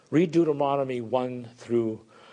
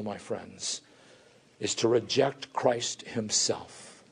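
ordinary talk, nothing marked

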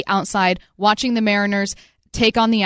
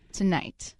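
much softer, ominous echo; dramatic hush; 5 semitones lower, 9 dB quieter than usual. Acted. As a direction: drop to a low hush. Dramatic Mariners-Astros hype.

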